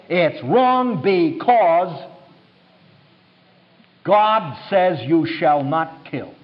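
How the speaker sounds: very slow, weighted words; solemn pronouncement. Retold or imitated. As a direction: spell it out word by word, with a long pause. Spells out opponent's hidden belief.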